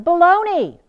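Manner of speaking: mock-outraged rejection; emphatic dismissal. Real, not acted. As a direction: bat the idea away. Rejecting a common belief.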